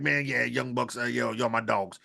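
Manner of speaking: low, soft mock Okada voice; imitating Okada. Podcast host imitating Okada to his co-hosts, low and soft. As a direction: murmur it low like a laid-back wrestler. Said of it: "he probably like".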